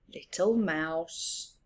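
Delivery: plain reading voice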